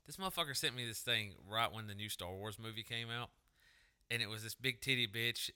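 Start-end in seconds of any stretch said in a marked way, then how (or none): none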